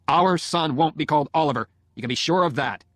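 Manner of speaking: husband laying down the law; angry. A narrator's reading. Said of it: "I said angrily".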